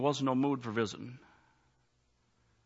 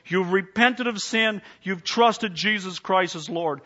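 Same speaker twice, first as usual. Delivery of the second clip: higher and louder, pleading altar call; fiery preaching; 6 semitones higher, 7 dB louder than usual. Acted. Pastor urging the congregation to come forward, fiery preaching. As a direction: preach it at full force to save souls.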